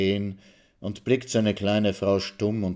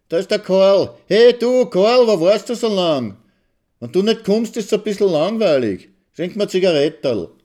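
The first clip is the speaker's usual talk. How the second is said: much higher and louder eager call; excited calling; 12 semitones higher, 8 dB louder than usual. A narrator's reading. Mitzi running to greet Karl, calling out high and loud. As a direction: call across the square to him.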